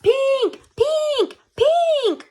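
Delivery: high, loud beeping alarm; alarm sound. Acted. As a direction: ring out like an alarm clock. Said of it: "went my alarm".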